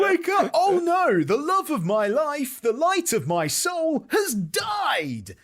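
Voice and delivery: very high, loud, slowing melodramatic shout while laughing; slow, shouted falsetto tragedy through laughter; laughing mock lament. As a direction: overplay the grief, cracking up. Actor wailing over Juliet.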